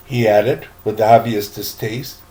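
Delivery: plain reading voice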